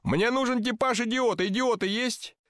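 high-pitched demanding call. A narrator's reading. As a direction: put the request to a whole crowd. Director calls out to the crowd.

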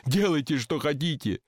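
usual speaking voice

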